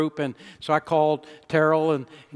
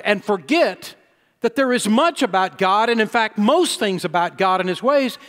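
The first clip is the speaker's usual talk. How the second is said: climbing sermon intensity; rising preaching; 6 semitones higher, 6 dB louder than usual. Acted. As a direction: build from firm to a loud, high pulpit cry. Preaching builds to full force.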